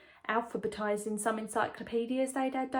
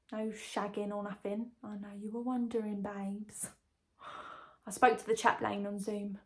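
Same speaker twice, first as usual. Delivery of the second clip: normal talking voice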